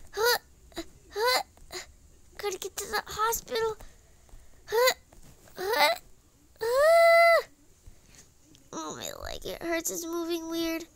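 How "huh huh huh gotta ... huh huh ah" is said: whiny mock sobs, higher; fake crying. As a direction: sob theatrically while pleading. Hurt character heading to hospital.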